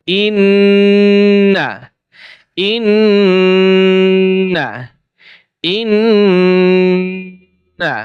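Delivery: ইন্না with the নন held long and humming through the nose; long nasal ghunna. Shown as a demonstration. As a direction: stretch the doubled ন in ইন্না into a slow nasal hum. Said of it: "আমি গুন্নাটা শব্দ করছি, আপনি দেখেন কিভাবে করি".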